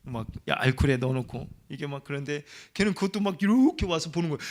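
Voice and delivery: usual speaking voice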